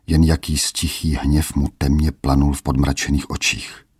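usual speaking voice

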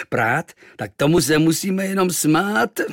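mocking sailor. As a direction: laugh him down, slowly.

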